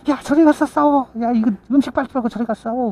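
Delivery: high, fast yell to take the fight elsewhere; shooing yell. Retold or imitated. Neighbor yells at the fighting women to move, high and fast. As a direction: shout them away from the food.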